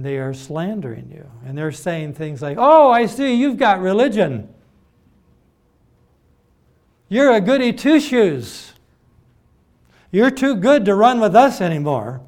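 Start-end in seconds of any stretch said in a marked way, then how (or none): 2.6-12.2 loud, high jeering imitation of scornful friends; mock-scornful, much louder and higher; mocking slam. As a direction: throw it out as a loud taunt, pitched up. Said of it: "that kind of slamming of people".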